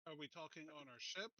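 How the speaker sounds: normal talking voice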